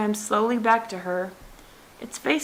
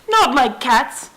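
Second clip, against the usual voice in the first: louder, slow indignant outcry; shrill, passionate; 3 semitones higher, 7 dB louder, slower than usual. A narrator's reading. Mouse shrieks at Alice about cats, shrill and passionate. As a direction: shriek it in outrage. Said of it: "cried the mouse in a shrill, passionate voice".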